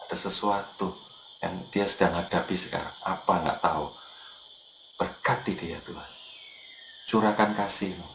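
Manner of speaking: soft, low murmured prayer to oneself; silent prayer. Retold or imitated. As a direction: pray quietly under your breath. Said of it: "Saya yang tahu langsung".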